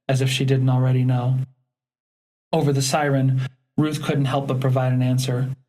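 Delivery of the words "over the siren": usual speaking voice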